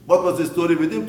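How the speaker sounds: emphatic repeat. Shown as a demonstration. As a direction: repeat it, pushing it out to the crowd.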